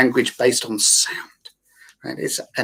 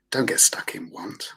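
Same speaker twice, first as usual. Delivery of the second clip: hushed warning; 4 semitones lower, 6 dB quieter than usual. Real, not acted. Coach quietly warning listeners not to get stuck. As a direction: lean in and breathe the warning. Emphatic advice to avoid wanting.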